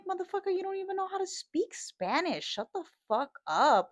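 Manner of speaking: high-pitched retold rant, ending loud; exasperated telling-off. Rider scolds passenger about his Spanish, high exasperated outburst.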